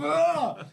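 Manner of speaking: high, pained imitated grunt; mock grunt of impact. His face smashing off the seat, a mock grunt. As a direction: act out getting hit in the face.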